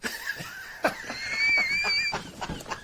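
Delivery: high, shrieking laugh; big laugh. Real, not acted. Listeners reacting to the joke's punchline, high laughter.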